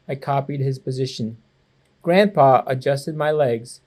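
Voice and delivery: ordinary delivery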